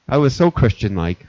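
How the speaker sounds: ordinary talk, nothing marked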